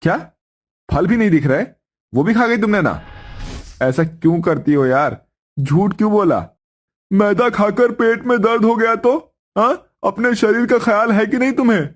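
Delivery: husband scolds his wife for eating too much; scolding husband. Acted. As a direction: tell her off with worried exasperation. Narrator voices husband scolding.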